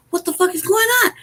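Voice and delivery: high-pitched baffled question; retold disbelief. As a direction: reenact being alarmed and baffled, without shouting. Retold bewildered protest.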